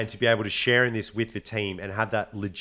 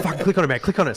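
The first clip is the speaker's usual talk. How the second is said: higher, pushy retold urging; excited urging; 6 semitones higher, 4 dB louder, much faster than usual. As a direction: imitate a mate egging you on, pitched up. Retold mate urging him on.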